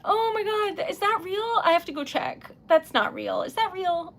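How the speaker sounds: ordinary talk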